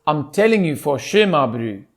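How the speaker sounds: South African catchphrase to reset the accent. Shown as a demonstration. Coming back to that word when lost, South African accent. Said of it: "coming back to that word".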